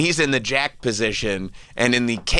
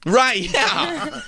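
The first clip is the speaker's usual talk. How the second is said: laughing shout. Real, not acted. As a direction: crack up at your own joke. Laughing at own bit.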